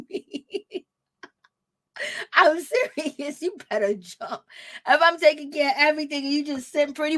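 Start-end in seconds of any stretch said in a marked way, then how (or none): none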